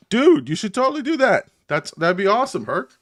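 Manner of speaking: high, loud burst of enthusiasm; excited encouragement. Real, not acted. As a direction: cheer a friend on, pitch leaping up. Hyped encouragement to a friend.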